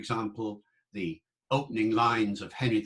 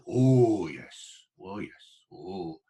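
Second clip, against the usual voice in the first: lightly Welsh "Oh, yes" fading to a hush; 4 semitones lower, 4 dB louder, much slower than usual. Shown as a demonstration. Lecturer imitating a Welsh speaker's "Oh, yes". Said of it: "that's Wales here".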